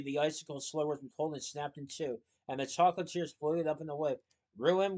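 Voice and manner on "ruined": loud, higher-pitched echoed question; calling out. A narrator's reading. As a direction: toss the word back at them. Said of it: "Willie called to them".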